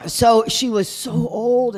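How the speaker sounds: drawn-out amazement. Retold or imitated. Repeats shock at age.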